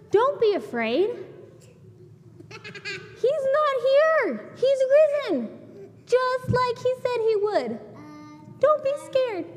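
ringing good news from an angel; angel's announcement. Retold or imitated. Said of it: "guess what the angel said".

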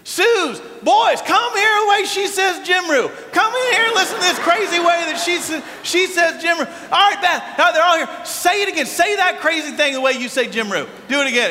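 excited dad hollering across the house; calling the family. Retold or imitated. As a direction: yell for everyone to come in. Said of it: "He then calls my brothers and his wife".

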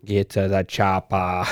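put-on slow, drawn-out Austrian Schwarzenegger accent. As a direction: stretch each word like an Austrian movie hero in a Schwarzenegger accent. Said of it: "We should do a podcast only on accents".

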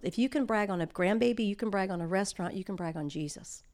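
ordinary delivery

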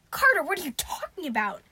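loud, exasperated cry; screaming. A narrator's reading. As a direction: cry out in alarmed disbelief. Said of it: "Sadie screamed".

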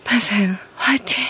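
sexy breathy whisper; breathy vintage purr. Acted. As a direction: breathe it out seductively. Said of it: "그 섹시야?".

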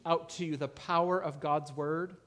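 normal talking voice

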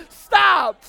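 sudden loud playful stop; shouted stop. Real, not acted. Preacher shouting at a laughing crowd, loud stop. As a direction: bark it out to cut the laughter off.

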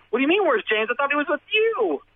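very high, incredulous retort; incredulous alarm. Retold or imitated. Teller answers employer asking after James, incredulous alarm. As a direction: pitch way up in retold disbelief.

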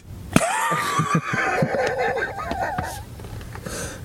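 helpless shrieking laugh; burst of high laughter. Real, not acted. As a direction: crack up at the insult. Big laugh after insult.

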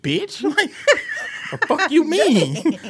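high, slow, laughing indignation; fake-offended laugh; laughing mock outrage. Host laughing at a friend doubting her topic. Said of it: "I was like".

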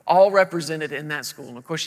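ordinary talk, nothing marked

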